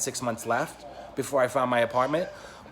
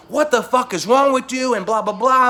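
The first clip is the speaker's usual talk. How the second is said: loud, higher indignant yell down the phone; retold angry shout; 9 semitones higher, 6 dB louder than usual. Yelling at his friend on the phone, loud furious shout. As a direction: blast him with outrage.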